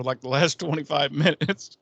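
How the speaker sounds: ordinary talk, nothing marked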